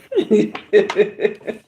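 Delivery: short amused laugh; little laugh at herself. Real, not acted. Laughing at her own accent.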